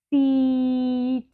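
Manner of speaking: drawn out slowly. Shown as a demonstration. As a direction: stretch Seat out long. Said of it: "slow and then fast".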